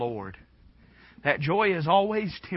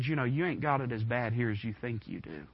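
quiet advice; 3 semitones lower, 6 dB quieter than usual. Retold or imitated. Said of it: "He would have probably said".

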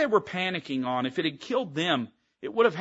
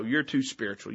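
ordinary talk

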